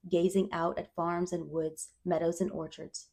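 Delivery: usual speaking voice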